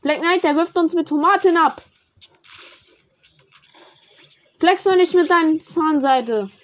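loud, high outcry; excited shout. Real, not acted. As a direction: yell it to the squad. Shouting about tomato attack.

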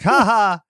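mock random "ha ha" laugh; flat fake laugh. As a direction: deliver a wooden, random laugh. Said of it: "And he's like".

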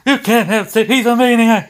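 high loud panicked protest; Jim Cornette voice. Retold or imitated. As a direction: squeal it like a frantic manager.

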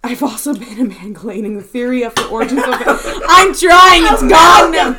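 laughing and exasperated; loud, laughing frustration. Real, not acted. Reading loses word, laughing.

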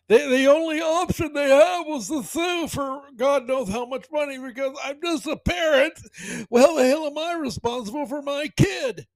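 high, loud, put-on complaining voice; hammed-up helpless parent protesting; mock indignant parent. Host mocking parents who sue over Fortnite, high indignant whine.

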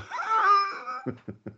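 helpless high-pitched cackle; high squealing laugh. Real, not acted. Friend laughing at dad story, squealing high laugh.

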